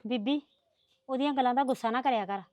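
low, soft appeal; quiet, conciliatory voice; soothing. Acted. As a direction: gently talk an angry elder down. Calming the angry mother-in-law.

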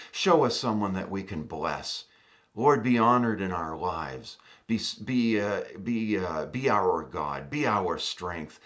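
low, earnest prayer voice; closing prayer over the congregation. Acted.

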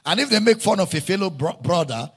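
loud, rousing preacher voice, pushing each line out; fiery preaching. Acted. Pastor rousing his congregation, preaching at full force.

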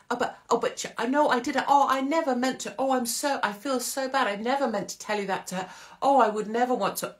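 high, flustered gushing excuses; put-on high-pitched, over-sorry protest; mock apologetic protesting. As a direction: imitate someone backpedalling in a rush of apology. Imagined gossip protesting when confronted.

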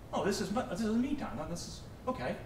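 lightly voiced self-absorbed kid, rising lilt; a hint of a smug teen savouring his me time; mock self-centred student.

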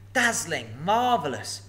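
high, loud flattering exclaims; gushing praise. Acted. Bilbo flattering the dragon, high gushing. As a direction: lay the flattery on thick. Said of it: "exclaimed Bilbo aloud".

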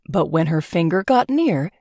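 usual speaking voice

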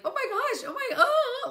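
very high, loud squeal; high-pitched gasping cries with a laugh; overwhelmed exclamation. Retold or imitated. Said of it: "we were overtaken by".

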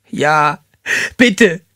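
high, desperate plea; pleading. A narrator's reading. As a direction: beg for mercy, voice climbing. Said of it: "flehte sie".

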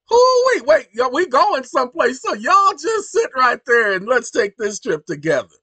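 loud whoop, then loud rollicking invitation; hyped-up talk. Real, not acted. Host rallying listeners to take this trip together, exuberant. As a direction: whoop high and carry the crowd along. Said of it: "Why you talking like that".

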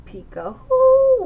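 high, loud, drawn-out peekaboo; spring the surprise on the child; playful peekaboo reveal. Acted. Teacher to toddler, revealing the owl with a high, loud peekaboo.

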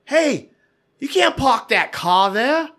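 high, drawn-out Boston yell with dropped R's; Boston accent. Retold or imitated. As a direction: holler it like a Boston local. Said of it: "that's my Boston accent".

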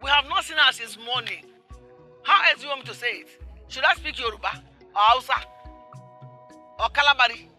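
exasperated. Acted. Woman tired of repeating that Chioma is missing, exasperated. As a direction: spell it out, fed up. Exasperated at being asked again.

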